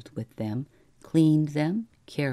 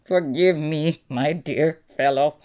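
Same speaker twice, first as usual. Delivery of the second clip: loud, laughter-choked apology; gasping with mirth; 6 dB louder than usual. A narrator's reading. Employer apologizing to clerk after convulsive mirth, gasping. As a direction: apologize while catching your breath from laughing. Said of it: "he managed to gasp at last".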